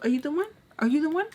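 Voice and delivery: high rising are you the one; excited high question. Retold or imitated. Excited realization.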